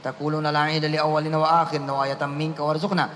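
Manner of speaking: fast, soft recitation of the prayer; murmured prayer. Acted. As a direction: rattle the prayer off under the breath.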